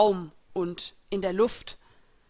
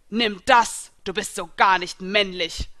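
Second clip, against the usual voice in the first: a different, louder battle cry; loud rescuer's battle cry; called out; 7 dB louder than usual. A narrator's reading. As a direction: shout it as you strike. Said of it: "rief jemand".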